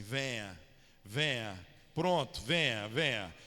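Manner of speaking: Jesus as a Bahian saying "pronto, venha"; Bahian accent. Retold or imitated. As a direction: invite them in like someone from Bahia. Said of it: "Se fosse contextualizado no baiano".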